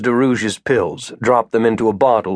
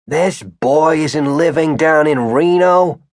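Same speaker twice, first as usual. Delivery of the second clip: put-on slow, higher old man's voice; suspicious old man; 5 semitones higher, slower than usual. A narrator's reading. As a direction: think it out slowly, unconvinced. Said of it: "Mister Hagopian thought".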